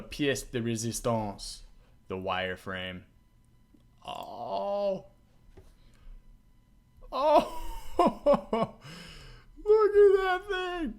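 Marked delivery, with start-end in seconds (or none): 4.1-10.9 much higher, louder, slow "look at that thing"; real awe spilling into laughter; high, loud, delighted gasp of wonder; amazed and laughing. Real, not acted. Wireframe revealed, amazed.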